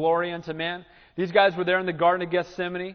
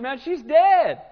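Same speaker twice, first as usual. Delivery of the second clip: mocking scorn; 10 semitones higher than usual. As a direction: sneer that it's over, pitched way up. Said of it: "they ridiculed and said".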